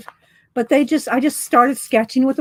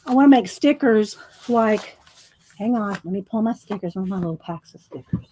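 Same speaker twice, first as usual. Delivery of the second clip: normal talking voice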